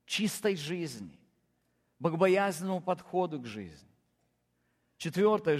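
normal talking voice

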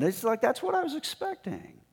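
high, fast indignant whine; mock outrage. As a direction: squeak it out like an offended official. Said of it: "Naaman's indignant complaint".

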